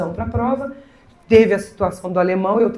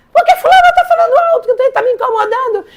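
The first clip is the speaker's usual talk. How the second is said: agitated student; 6 dB louder than usual. Retold or imitated. Upset student complaining.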